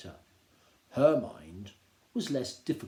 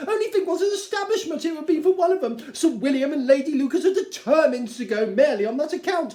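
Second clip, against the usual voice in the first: much higher and louder, urgent; pleading Mrs Bennet; 13 semitones higher, 11 dB louder than usual. Acted. Wife pleading with husband, high and urgent. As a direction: beg him to go.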